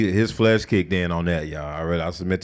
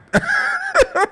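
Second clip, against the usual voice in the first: high laugh. Real, not acted. Laughs at his own retelling.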